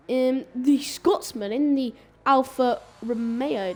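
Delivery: usual speaking voice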